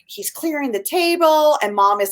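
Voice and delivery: ordinary talk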